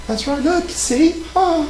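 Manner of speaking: prim, proper British lady voice; British accent, ladylike; put-on British accent. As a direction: play a posh English lady flirting. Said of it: "the accent is annoying the crap out of me".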